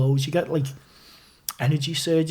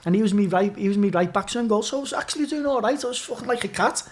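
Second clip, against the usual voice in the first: ordinary talk, nothing marked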